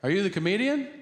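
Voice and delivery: high-pitched call-out to a neighbor; imitated yell. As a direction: yell it across the yard as an excited question. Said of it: "But he yells out".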